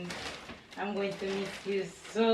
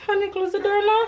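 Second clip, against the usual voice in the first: loud, high call across the house; 13 semitones higher, 13 dB louder than usual. Real, not acted. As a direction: call him from another room. Calling to son across house.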